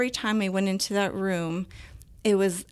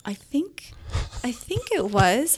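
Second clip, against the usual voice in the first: higher-pitched, wavering uncertainty; dazed; 4 semitones higher than usual. Retold or imitated. Student answers her friend after class, dazed and unsure. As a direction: answer as if still stunned by the workout. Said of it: "like, dazed".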